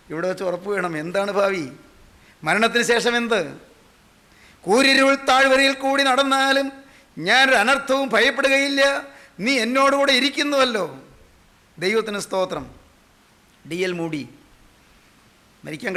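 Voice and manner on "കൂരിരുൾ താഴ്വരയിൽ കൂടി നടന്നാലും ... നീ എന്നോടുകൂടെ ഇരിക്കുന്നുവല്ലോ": ringing, sustained preaching voice; scripture declaimed. Real, not acted. Preacher quoting the valley of darkness psalm, bold declaiming. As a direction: proclaim the psalm boldly from the pulpit.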